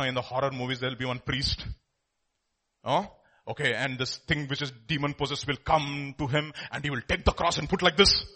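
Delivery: usual speaking voice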